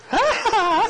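hohes, spöttisches Lachen; hämisch hoch auflachen; auslachen. Acted. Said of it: "die anderen auszulachen". (spöttisch lachende Kerzen)

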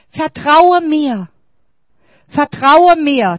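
slow, loud, high calling out; God's cry. Acted. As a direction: call it out slowly like a cry from above. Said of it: "Gottes Schrei heute Morgen". (Gottes Schrei, langsam, laut und hoch)

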